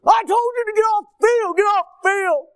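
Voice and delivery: very high, loud repeated shouting; angry yelling. Retold or imitated. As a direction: yell the order in a fury. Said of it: "I had gotten angry".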